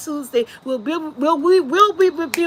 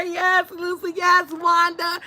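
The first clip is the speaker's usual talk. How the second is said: normal talking voice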